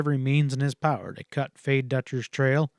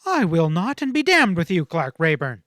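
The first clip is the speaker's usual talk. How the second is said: louder, hard refusal peaking on "damned"; flat refusal; 5 semitones higher, 6 dB louder than usual. A narrator's reading. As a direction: refuse him with heat. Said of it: "she said flatly".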